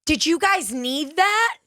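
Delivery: high, slow syrupy politeness; sweetly drawn-out taunt; fake sweet. [name] taunting the women with the napkin, slow and syrupy. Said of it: "I'm saying, I go".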